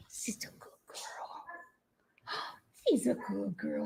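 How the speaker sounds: soft excited whisper; whisper to dog. Real, not acted.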